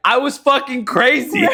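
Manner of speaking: high, loud comic outburst; imitated exclamation. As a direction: blurt it out as dad's comic confession. Imitating dad's blurted confession.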